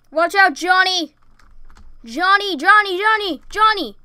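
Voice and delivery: high, loud frantic calls; panicked shouting. Acted. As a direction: scream the warning in panic. Panicked warning to Johnny.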